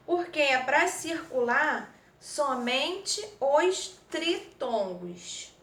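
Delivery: slow down and lean on "somente os tritongos"; slow emphatic instruction. Shown as a demonstration. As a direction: drag out "tritongos" to make the point stick. Teacher stresses the key instruction. (ênfase lenta em "somente os tritongos")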